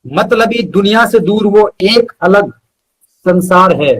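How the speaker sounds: measured, rhythmic recital with pauses between phrases; unhurried poetry reading, each line landing on its end; poem recitation. Acted. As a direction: recite it like a heartfelt ode to your best friend. Recites his friendship poem.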